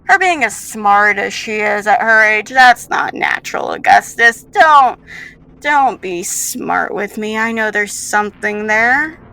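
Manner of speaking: loud, insistent villain; suspicious rival. Acted. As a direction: press the accusation forcefully, building to a warning.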